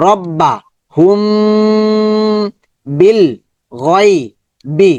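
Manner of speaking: "রব্বা, হুম, বিল, গয়, বি" broken into separate pieces, "হুম" held in a long hum; syllable by syllable. Shown as a demonstration. Teacher breaks up the reading, syllable by syllable. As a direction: pause between syllables, stretching only "হুম". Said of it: "সিলেবলের মতো ভেঙে ভেঙে পড়বো".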